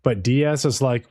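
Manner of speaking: usual speaking voice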